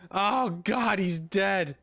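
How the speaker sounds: ordinary talk, nothing marked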